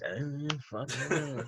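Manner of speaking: soft, slow fed-up murmur; slow, quiet mock disgust; muttered disbelief. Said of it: "shaking their heads".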